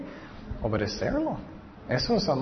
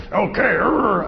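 hammed-up angry growl, pitched up; mock angry; 6 semitones higher than usual. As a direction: play a grumpy, growling God. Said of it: "enojón".

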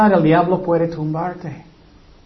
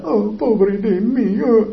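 mock self-pity; 4 semitones higher, slower than usual. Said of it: "él era".

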